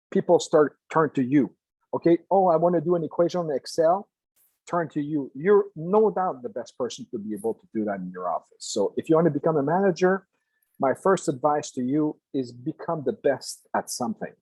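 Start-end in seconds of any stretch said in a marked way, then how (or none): none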